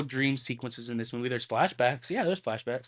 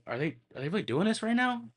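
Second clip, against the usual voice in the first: incredulous; 9 semitones higher, faster than usual. Retold or imitated. Inner disbelief while watching.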